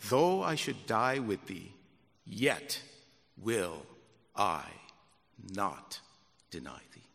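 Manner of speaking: each word set apart, fading low; measured vow. Acted. Peter vowing to Jesus, slow measured words. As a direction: space out every word.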